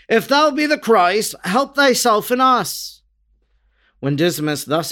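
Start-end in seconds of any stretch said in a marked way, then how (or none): 0.1-3.0 crying out. A narrator's reading. Gesmus jeering at Jesus from the cross, crying out. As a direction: jeer again, mildly acted. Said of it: "Gesmus was again crying to Jesus".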